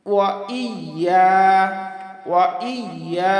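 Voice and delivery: slow "ওয়া ইয়া", distinct I, held YA; sounded hamza. Shown as a demonstration. Teacher modelling it, slow sounded hamza. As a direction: lean on the I after "ওয়া". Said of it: "তাহলে কি হবে?".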